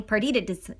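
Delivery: plain reading voice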